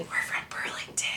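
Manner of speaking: hushed, joking confession under the breath; whispered aside. Acted. Singer undercutting the band's Hamilton boast, whispered aside. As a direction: whisper it like an embarrassing secret. Whispered correction to the band joke.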